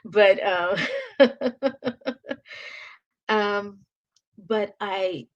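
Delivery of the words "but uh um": real tears breaking through a laugh; tearful laugh through crying. Real, not acted. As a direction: laugh off the tears that come anyway. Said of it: "I'm not gonna cry today".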